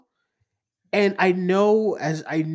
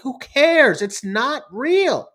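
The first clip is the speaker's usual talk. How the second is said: exasperated; 7 semitones higher, 7 dB louder, much slower than usual. Retold or imitated. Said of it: "I'm like".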